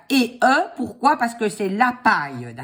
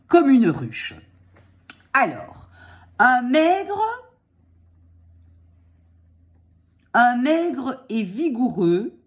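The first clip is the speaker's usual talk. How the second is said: dictating; 4 semitones higher than usual. Shown as a demonstration. Dictating slowly.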